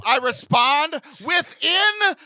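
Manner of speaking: giddy shout of delight; excited. Real, not acted. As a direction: gush about it like a proud fan. Bragging about instant replies.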